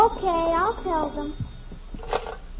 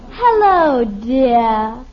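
louder, drawn-out hostess greeting; little sister mimicking the caller's affected talk; imitating a posh voice; 8 semitones lower, 7 dB louder, much slower than usual. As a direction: ham up a refined lady. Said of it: "she talks just like Betty does".

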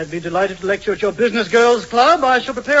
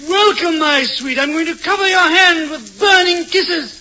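hammed-up smooth seducer, higher and louder; suave lover; 6 semitones higher, 4 dB louder than usual. To Dolores, a hammy matinee-idol lover greeting. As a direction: lay the charm on thick like a matinee idol. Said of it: "feel like Walter Pidgeon".

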